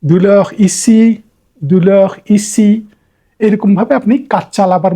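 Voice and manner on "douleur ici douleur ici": douleur ici drawn out very slowly, louder; slow model phrase. Shown as a demonstration. Teacher repeating the phrase for telling a doctor it hurts. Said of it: "তখন আপনি বলবেন".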